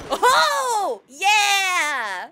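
high, loud victory yell; a real whoop of triumph; triumphant shout. Real, not acted. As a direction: cheer the win at full voice. Triumph in game fight.

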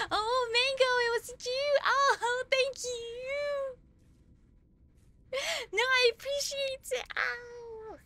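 high-pitched squeal of gratitude; so excited. Real, not acted. Streamer finds out Mango was the giver, excited squeal. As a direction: squeal your thanks, overjoyed at who it was. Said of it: "I'm so excited".

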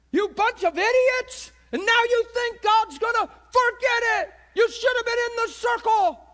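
high, very loud acted tirade; furious condemnation. Imagined Peter berating the murderers, furious shouting. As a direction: scream accusations at them, hammed-up outrage.

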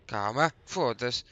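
slow, loud panicked plea; being chased. Real, not acted. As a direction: drag it out in panic.

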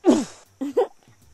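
high, loud burst of laughter. A narrator's reading. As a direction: laugh out at the bounce. Watching Douglas bounce.

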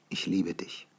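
softly, Ich liebe dich barely voiced; soft tender Ich liebe dich; tender confession. A narrator's reading. She confesses love.

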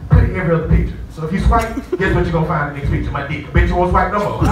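ordinary talk

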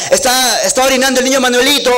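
high, fast mock shout at a fiesta; people shouting. Comedian voicing villagers shouting at the fiesta, high and fast. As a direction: call it out over the rain, half-hearted yell. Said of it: "la gente grita".

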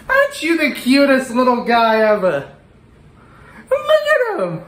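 put-on high cooing baby voice; baby talk. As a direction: gush over it like a puppy. Cooing at the pepper.

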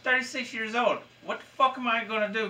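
high-pitched, indignant disbelief; retold incredulity. Employee replaying his reaction to being told to make friends, incredulous. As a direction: throw the words back, high and incredulous. Retells indignant reply to boss.

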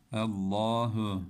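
Allahu with a thick, dark double l, drawn out slowly; Allahu, lam made full and dark; heavy lam in Allah. Shown as a demonstration. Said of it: "Güzel okuyalım".

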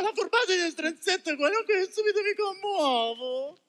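hammed-up weepy; mock tearful. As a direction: blubber a little at your own kindness. Mangiafuoco moved to tears. (commosso fino alle lacrime, esagerato)

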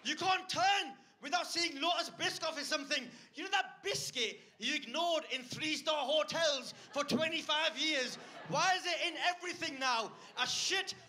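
high, full-throated shouted tirade; comic angry rant. Acted. Comedian ranting to crowd about Biscoff, comic angry shouting.

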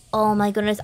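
ordinary talk

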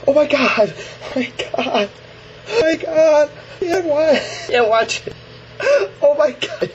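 real horror at a gory clip; horrified shrieks. Real, not acted. Horrified watching gory video.

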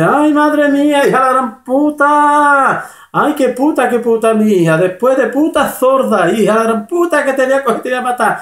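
a hammed-up furious outburst of threats; furious mother. Mother explodes at her daughter.

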